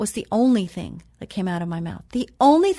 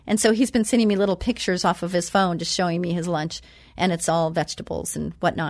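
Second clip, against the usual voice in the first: normal talking voice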